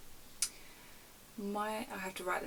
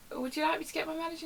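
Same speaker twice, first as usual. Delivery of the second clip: high, quick customer-service voice; imitated polite call handler; strained politeness; 9 semitones higher, faster than usual. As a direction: ask an irate caller with forced courtesy. Polite handler to angry caller.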